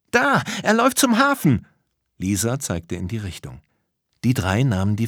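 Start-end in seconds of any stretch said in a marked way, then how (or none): 0.1-1.7 put-on high, urgent girl's cry; sudden high-pitched shout; excited alarm. A narrator's reading. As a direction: call out the sighting. Lisa spots the magician.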